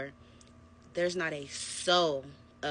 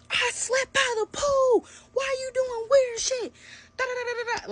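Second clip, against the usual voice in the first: mock screaming; 9 dB louder than usual. Boyfriend screaming his excuse about the pool, mock shriek. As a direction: shriek it like a tantrum. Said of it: "Like, screaming".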